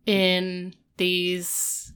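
ordinary talk

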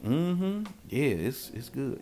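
soft, badly acted approval; faked enjoyment. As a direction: pretend it's good to spare their feelings. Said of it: "trying to be a thespian and act".

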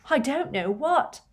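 high, tearful wail; sobbing. A narrator's reading. Leanne telling Hagrid about Katie, sobbing. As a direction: plead through sobs. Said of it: "sobbed Leanne".

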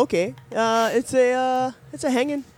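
bad Canadian accent. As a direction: put on a hammed-up Canadian voice. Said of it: "bad Canadian accents".

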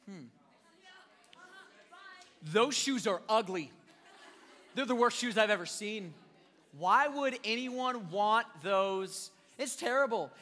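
mock disdain, a little laugh in it; sour grapes. Shopper seeing the price tag, mock disgust. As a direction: pretend the shoes are ugly. Acting out rejecting pricey shoes.

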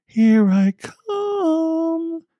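put-on very high, slow, drawn-out teasing child voice; teasing little girl. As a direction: stretch it out like a playful creepy girl. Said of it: "the little girl's voice, laughing teasingly".

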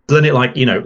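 ordinary talk

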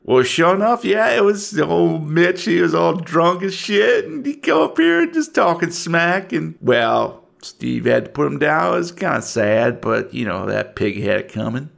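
lightly voiced gossiping deputy; a hint of small-town lawman; folksy deputy. Game master voices local deputy.

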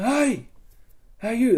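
high, projected hail; retold hailing call. Player hails enemy pickets, high distant call. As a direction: call across to the guards.

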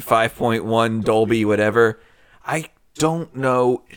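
ordinary talk, nothing marked

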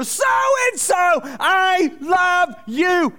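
high, loud drawn-out shout; screamed. Retold or imitated. Praying alone outdoors, shouting love for his rival. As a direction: scream the name out to the sky. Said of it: "I screamed this guy's name out".

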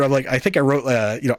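ordinary talk, nothing marked

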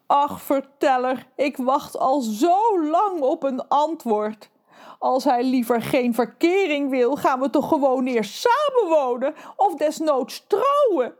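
a lovesick fish complaining to the narrator; lovesick fish Trudy. Acted. (Trudy de vis klaagt tegen de verteller, hoog en traag)

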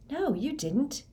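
high-pitched, stunned refusal; disbelieving denial. Acted. Harry rejects Moody's confession, disbelieving. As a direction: deny it in high, rising disbelief.

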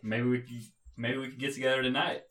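ordinary delivery

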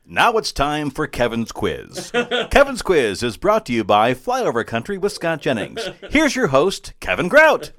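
hammed-up game show intro, building to the host's name; big mock announcer delivery.

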